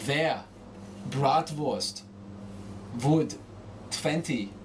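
"Wer, Bratwurst, Wood, twenty" with V for every W; German-accented "Wer, Bratwurst, Wood, twenty", W turned to V. Shown as a demonstration. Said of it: "changing W to V".

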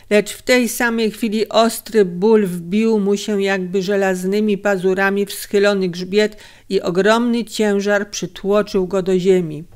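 ordinary delivery, nothing marked

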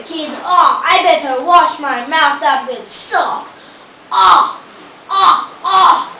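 usual speaking voice

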